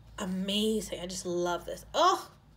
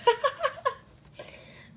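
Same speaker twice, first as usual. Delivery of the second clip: high giggle; 7 dB louder than usual. Real, not acted. Laughs at coffee sticker.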